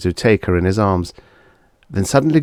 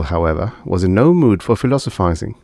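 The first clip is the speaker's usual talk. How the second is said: ordinary delivery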